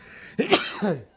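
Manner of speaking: sharp spluttering cough; real high cough. Real, not acted. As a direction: cough through a stuffed nose. Sneeze attempt, nose stuffed.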